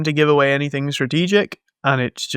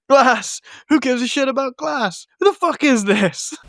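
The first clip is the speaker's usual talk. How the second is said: higher, incredulous mock rant; mock outraged Bruce; 9 semitones higher than usual. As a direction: toss the complaint back, scoffing. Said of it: "And Bruce is like".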